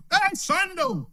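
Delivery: high, sing-song hey Sando; mock churchgoer greeting. Preacher mocking a congregant greeting the pastor, high and sing-song.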